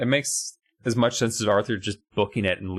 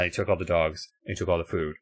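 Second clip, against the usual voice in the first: ordinary delivery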